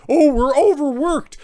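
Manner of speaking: high, slow mocking whine of a tired doctor. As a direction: squeak it out like a self-pitying nurse.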